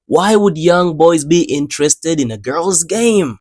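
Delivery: usual speaking voice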